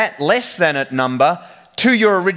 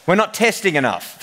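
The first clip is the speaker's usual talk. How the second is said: put-on Australian accent; 5 semitones higher than usual. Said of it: "He was really Australian".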